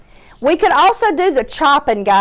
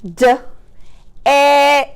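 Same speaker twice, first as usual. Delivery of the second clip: "D-, e-, k" as three separate sounds; chopped word; 3 semitones higher, slower than usual. Shown as a demonstration. Teacher showing pupils the chops, sounds split apart. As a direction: chop DECK with gaps. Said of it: "let's chop the word deck".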